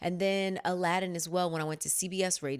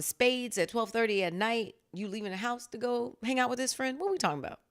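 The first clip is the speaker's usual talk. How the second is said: ordinary delivery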